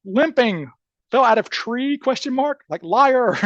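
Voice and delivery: all caps notes. Retold or imitated. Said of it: "noting in all caps".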